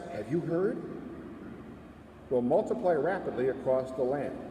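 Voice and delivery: normal talking voice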